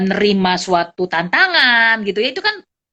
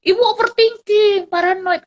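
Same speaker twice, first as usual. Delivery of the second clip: higher-pitched imitation of a critic; mild mock accusation; 11 semitones higher, 3 dB louder than usual. Someone telling the teacher she overthinks, mock accusation. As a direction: lightly act out someone accusing you. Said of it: "ada orang bilang ya".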